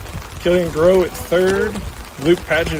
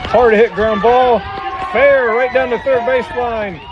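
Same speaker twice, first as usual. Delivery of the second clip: excited call; 6 semitones higher, 4 dB louder than usual. Real, not acted. Live call of a hard hit.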